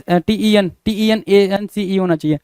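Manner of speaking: ordinary talk, nothing marked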